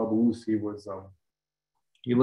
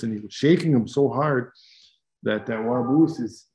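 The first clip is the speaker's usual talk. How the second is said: usual speaking voice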